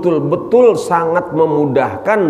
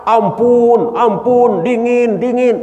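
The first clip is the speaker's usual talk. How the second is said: higher, pleading mock wail; mock cry for mercy; 7 semitones higher than usual. Nafsu freezing in the sea, pleading high. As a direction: beg for mercy, freezing, half-acted. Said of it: "Teriak-teriak".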